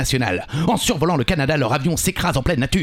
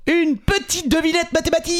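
robotic vocoder voice, pitched way up. Real, not acted. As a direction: talk through a vocoder filter. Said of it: "Avec le vocodeur".

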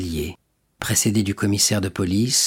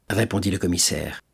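plain reading voice

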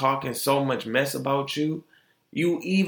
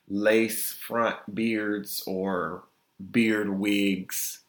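slow, low and drawn out; deliberate disbelief; 4 semitones lower, 4 dB quieter, much slower than usual. Real, not acted. Listing beard wigs to listeners, slow. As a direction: spell each word out slowly. Stressing absurd items.

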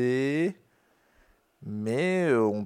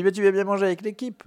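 cheerful invitation; 9 semitones higher, 6 dB louder, faster than usual. Retold or imitated. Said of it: "On me dit".

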